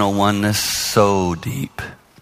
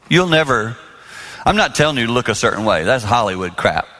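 ordinary talk, nothing marked

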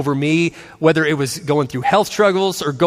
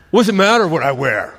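put-on teen son. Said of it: "And he's like".

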